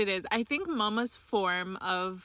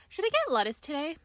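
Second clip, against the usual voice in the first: fast, higher-pitched run-on chatter; rambling mom impression; 3 semitones higher, much faster than usual. Daughter mimics mom's phone call about lettuce, rambling fast. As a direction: rattle on without a break, imitating mom. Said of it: "she literally goes on".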